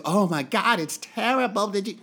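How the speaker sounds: high, mocking gasp of horror; hammed-up mock horror; mock shocked grief. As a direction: parody fake outrage, hammed-up. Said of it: "I'm not going to".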